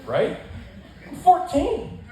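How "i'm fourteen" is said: high, louder exasperated cry; high indignant yelp. Real, not acted. Comic telling the crowd, high indignant yelp. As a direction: blurt it out in disbelief.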